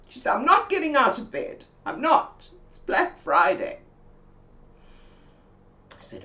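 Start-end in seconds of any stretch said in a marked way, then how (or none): 0.4-3.8 higher, louder stubborn refusal; stubborn friend. Retold or imitated. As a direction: dig in like a sulking friend. Mabel refusing from bed.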